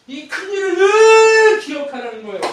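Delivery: very high, loud proclamation; shouted preaching. Acted. Preacher crying out the charge to remember, very loud. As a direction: proclaim it as loud as you can.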